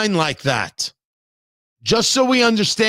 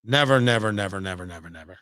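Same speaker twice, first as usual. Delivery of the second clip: each never softer until it trails away; fading repeated never; 13 semitones lower, 6 dB quieter, faster than usual. Real, not acted. Host insisting his mother never did it, fading out. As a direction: let the nevers die down to almost nothing.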